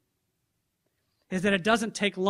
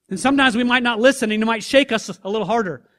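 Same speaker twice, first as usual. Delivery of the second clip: forceful insistence; 8 dB louder, faster than usual. Shown as a demonstration. Escalating God's call to listeners.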